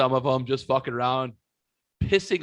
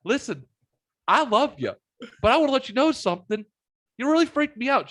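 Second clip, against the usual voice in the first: high earnest plea; 11 semitones higher, faster than usual. Retold or imitated. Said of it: "I went".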